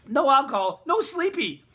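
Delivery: comic outburst. Real, not acted. Punchline about needing a drink.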